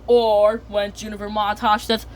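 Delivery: ordinary delivery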